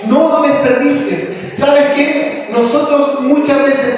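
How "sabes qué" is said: ordinary talk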